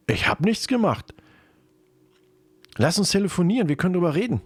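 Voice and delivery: high-pitched, defensive pleading; pleading denial. Acted. Accused boyfriend answers girlfriend's voice message, high-pitched pleading.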